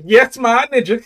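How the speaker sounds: high, loud, slow, laughing; delighted, cracking up; laughing quote. Real, not acted. Quoting lyric while laughing.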